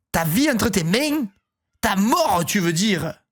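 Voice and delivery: slow, hammed-up Marseille accent; exaggerated Marseille accent. Brother challenging his sister, exaggerated Marseille accent. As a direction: draw it out in a mock Marseille accent. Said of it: "l'accent des tuches".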